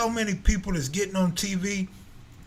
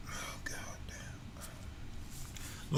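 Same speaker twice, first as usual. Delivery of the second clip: breathy whispered swear; whispered curse; 18 dB quieter, much slower than usual. Real, not acted. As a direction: whisper it under your breath.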